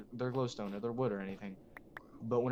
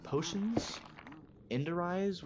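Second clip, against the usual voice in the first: excited; 8 semitones higher, slower than usual. Real, not acted. Excited item name.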